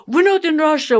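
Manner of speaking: loud, high mock protest from the boys; imitating the boys protesting loudly; kids' loud complaint. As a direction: whine it like an annoyed teenager. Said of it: "my boys will always say".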